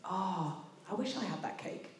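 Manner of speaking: a stranger's murmur from behind, barely there; a stranger muttering softly behind the speaker about the cake; little voice. Retold or imitated. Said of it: "I hear a little voice behind me say".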